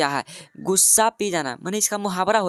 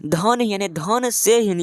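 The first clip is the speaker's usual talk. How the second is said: slowly and a bit louder, each part of धन से हीन set apart; slow spelled-out reading; 3 dB louder, slower than usual. Shown as a demonstration. Teacher slowly breaks up a compound word for students, louder. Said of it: "तो इसको उच्चारण करो".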